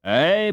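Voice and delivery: high, loud emphatic "Ay". Acted. Father cutting in firmly about school, emphatic. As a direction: put your foot down on "Ay". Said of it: "put in Raff Brinker emphatically".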